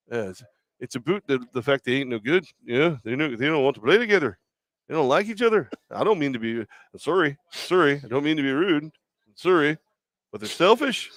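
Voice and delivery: put-on overly polite Canadian accent doing Steve Nash. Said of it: "I did the Canadian accent".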